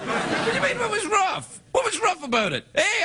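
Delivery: high-pitched, mock-indignant protest; comic indignation. Host protests being called rough, comically indignant. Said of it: "I'm being indignant for comedic reasons".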